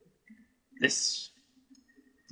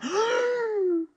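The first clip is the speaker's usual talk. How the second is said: very high, sharp gasp of delight; excited gasp; 6 dB louder than usual. Real, not acted. Player spots diamonds, high excited gasp. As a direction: gasp hard at a lucky find.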